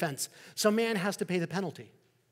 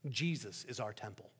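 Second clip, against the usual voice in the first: softer, lower, settled conviction; quiet confident answer; 5 semitones lower, 6 dB quieter than usual. Acted. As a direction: let the truth land gently.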